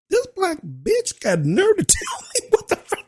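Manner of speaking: high, laughing inner voice. Retold or imitated. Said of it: "what she was thinking".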